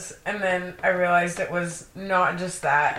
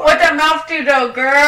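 much higher and louder sassy squeal; sassy mock girl; 9 semitones higher, 10 dB louder than usual. Teasing her cohost about the lipstick girl, high sassy squeal. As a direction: tease like a sassy bestie.

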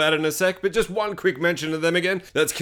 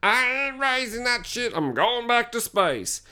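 lightly put-on alien, higher and slow; mock alien voice; 7 semitones higher, much slower than usual. Host voicing the alien as it lands, mock alien. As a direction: ham up a gleeful alien on the probe.

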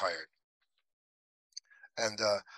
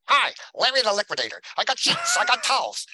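much higher, louder hammed-up pitchman; cheesy salesman voice; 11 semitones higher, 7 dB louder than usual. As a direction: belt it out like a cheap TV salesman. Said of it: "he laughed just like that".

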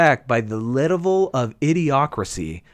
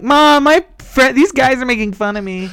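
whining to mom; 10 semitones higher, 4 dB louder than usual. Retold or imitated. Kid complaining to his mom, high childish whine. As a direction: cry to mommy like a little kid. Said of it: "I'm like".

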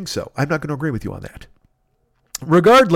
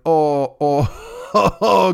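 sick moaning; much slower than usual. Retold or imitated. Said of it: "fall right into going".

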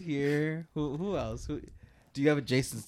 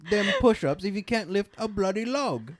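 higher, louder London scold; Michael Caine impression; 6 semitones higher, 3 dB louder than usual. Still doing Michael Caine, a higher mocking London scold. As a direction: scoff at the push-ups. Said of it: "Michael Caine".

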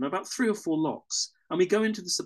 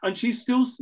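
normal talking voice

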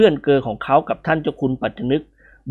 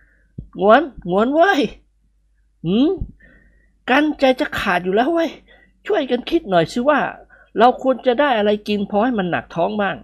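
higher, pleading hungry friend; character voice; 9 semitones higher, slower than usual. A narrator's reading. Hungry friend waking his pal, higher pleading voice.

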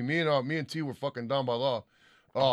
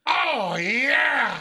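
high, loud drawn-out holler; Bobby; 10 semitones higher, 7 dB louder than usual. Retold or imitated. Host imitating a friend's cheer, loud high holler. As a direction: shout it long and high. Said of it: "that was like a Bobby saying it".

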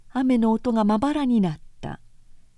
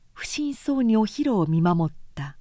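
ordinary delivery

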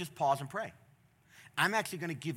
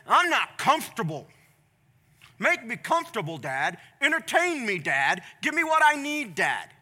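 put-on higher, louder spoiled kid; demanding child; 5 semitones higher, 8 dB louder than usual. Child demands comfort from father, spoiled whine. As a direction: insist like a bratty child.

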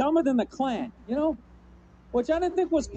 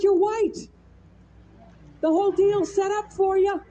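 louder, higher brush-off; mock scolding; 4 semitones higher, 5 dB louder, slower than usual. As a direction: scold the kid in mock outrage. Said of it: "It's like".

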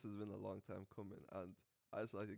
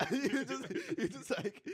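laughing; 9 dB louder than usual. Real, not acted. Laughing mid-story.

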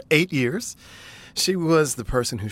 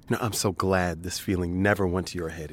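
ordinary talk

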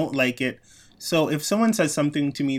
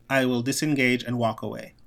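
ordinary delivery

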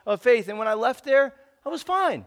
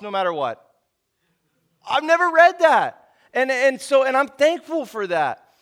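building preacher's fervor; preaching; 4 semitones higher, 6 dB louder than usual. Acted. Pastor urging his congregation, rising preacher fervor.

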